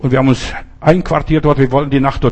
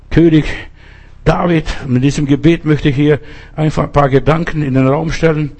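ordinary delivery

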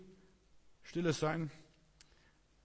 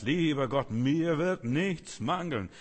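slow, loud, higher proclamation; solemn psalm recitation; 3 semitones higher, 6 dB louder, slower than usual. Retold or imitated. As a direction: declaim the psalm slowly with force. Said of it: "Und dann habe ich gesagt". (langsam, laut und feierlich ausgerufen)

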